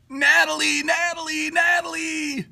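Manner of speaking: llamando en voz alta, mucho más agudo; llama a Natalie a gritos desde lejos; calling out. Real, not acted. Calling Natalie over on stream.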